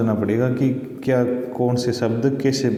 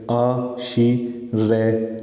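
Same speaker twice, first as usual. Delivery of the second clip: आ, शी, रे drawn out one syllable at a time, slowly; much slower than usual. Shown as a demonstration. Teacher sounds out आशीर्वाद for students, slow syllables. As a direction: spell out आ, शी, रे slowly, pausing after each. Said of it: "जैसे बोलने में भी आपको पता लगे".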